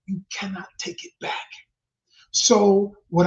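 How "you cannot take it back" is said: hushed whisper for weight. Real, not acted. Warning the audience that words can't be taken back, whispered.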